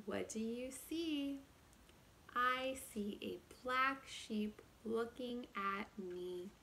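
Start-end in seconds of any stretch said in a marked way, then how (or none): none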